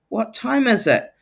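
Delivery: high rising question; question tune. Shown as a demonstration. Teacher showing pupils how a question sounds. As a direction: ask it with a big lifted tune. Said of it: "when it's a question".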